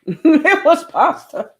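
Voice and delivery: high, slow words through real laughter; giggling while repeating the line; laughing. Real, not acted. Laughing at own pasta comment.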